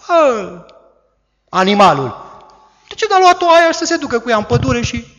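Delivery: high, loud, rough mocking wolf. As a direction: sneer like a greedy wolf eyeing the flock. Wolf voiced entering the flock.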